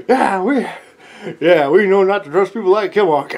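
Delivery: rowdy mob agreement. Acted. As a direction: gang up on him, smug and loud. Mob agreeing against Kimock.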